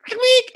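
very high squeaky click sound; imitated camera click. Mimicking the phone camera snap, squeaky click. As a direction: squeak out the shutter noise. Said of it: "It was like".

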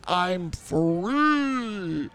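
slow, grand mock-heroic proclamation; voice of his fart. Host voicing his own fart to co-host, slow pompous proclamation. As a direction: announce freedom like a windbag hero.

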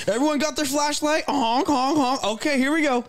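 high goofy honking voice; silly clown. Pretend. Silly honking over bird lights.

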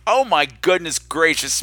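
normal talking voice

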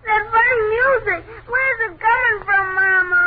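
loud, tearful, crying call; a scared boy crying for his mother; high, sobbing child's shout; frightened child. Acted. Frightened child wakes to music.

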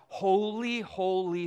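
high, loud angelic proclamation; proclaiming out. Acted. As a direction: proclaim it to the heavens. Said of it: "angels proclaiming out".

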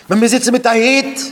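high, loud, fast challenge; acted loud protest. Acted protest about sitting with hat.

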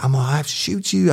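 high, fast urgent warning. Retold or imitated. Cop retelling warning the woman he would shoot. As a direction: rush out the threat. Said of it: "I said".

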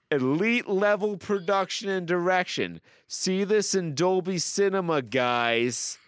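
high-pitched, gushing mock announcement; all-caps read-out. Host reading an all-caps review, high excited declaiming. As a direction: proclaim every word as if capitalized. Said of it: "in all caps".

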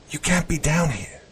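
high, worried scolding from an aunt; worried yell. A narrator's reading. Aunt finds the boy in the basement, worried mild yell. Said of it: "she yelled in a worried tone".

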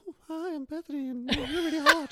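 mock Bethany voice. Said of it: "She goes".

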